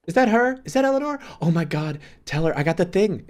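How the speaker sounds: high, loud eager calling out; excited background voice. Acted. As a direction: pitch it way up, thrilled to butt into the call. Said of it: "speak in the background. She goes".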